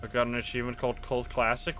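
ordinary delivery

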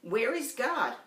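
a hint of a stern preacher; lightly voiced stern pastor. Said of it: "asked him sternly".

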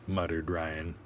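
ordinary delivery, nothing marked

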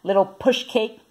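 put-on high-pitched call after a child; crying out. A narrator's reading. Babysitter calling a fleeing child back, high cry. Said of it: "cried Missus Block".

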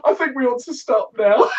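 high voice breaking into laughter; real helpless giggles; laughing. Real, not acted. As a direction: try to call a halt through real laughter. Helpless laughter after accent attempts.

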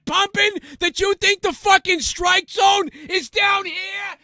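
full-throated Boston screaming rant, very high; angry Boston accent. Pretend. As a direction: berate an umpire in a furious Boston accent. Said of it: "That was a good Boston impression".

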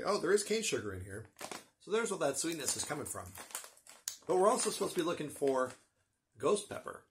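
ordinary delivery

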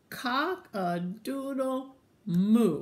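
slow, loud, high cow call; cow's crow. Acted. Cow nearly gets the crow, slow and loud. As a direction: stretch out a big mooing crow. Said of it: "this time the cow said".

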